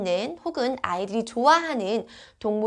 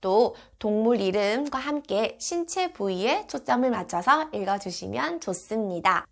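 ordinary talk

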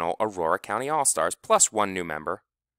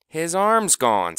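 slow, higher, louder grave announcement; soap opera doctor; 9 semitones higher, 7 dB louder, slower than usual. A narrator's reading. Wilkie tells the team the bad news, like a soap opera doctor. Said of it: "sounded like a soap opera doctor".

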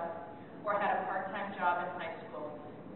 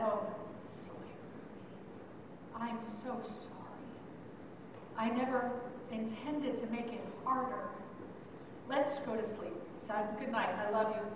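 tender apology; 9 dB quieter than usual. Retold or imitated. Mother apologizing softly to her anxious son at bedtime. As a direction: gently tell him you're sorry, much softer.

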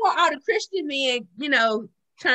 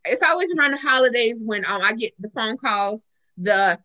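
normal talking voice

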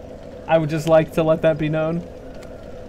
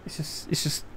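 whisper; 13 dB quieter than usual. Real, not acted. Nervous aside mid-fight.

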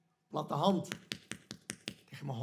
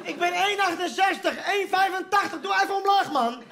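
laughing shout; 9 semitones higher than usual. Real, not acted. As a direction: yell through laughter at the too-high peephole. Complaining about the peephole height.

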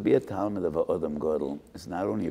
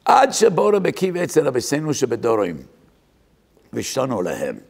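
climactic preaching; 7 semitones higher, 8 dB louder than usual. Acted.

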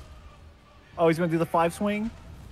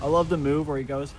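normal talking voice

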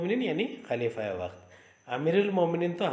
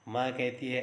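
ordinary delivery

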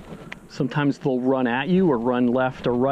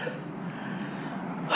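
long sigh; 13 dB quieter than usual. Real, not acted. Sigh while releasing fish.

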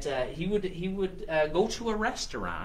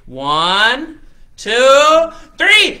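loud count rising higher on each number; building countdown; 7 semitones higher, 14 dB louder, much slower than usual. Acted. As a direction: count everyone in, climbing to a high shout. Leads a countdown to start challenge.

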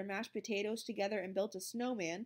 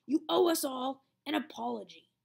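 stern demand; 4 semitones higher, 6 dB louder than usual. A narrator's reading. Monitor demanding apology.

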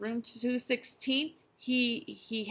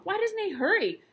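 anxious; 12 semitones higher, 6 dB louder than usual. Acted. Worried mouse thinking.

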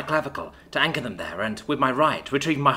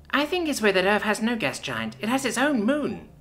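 put-on higher, childlike little girl voice; little sister; 6 semitones higher than usual. Little sister answering her big sister about Earth, higher childlike voice. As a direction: voice a young child, pitched well up.

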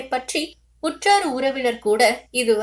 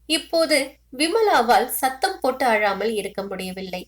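plain reading voice

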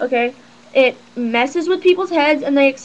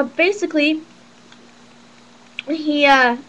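ordinary talk, nothing marked

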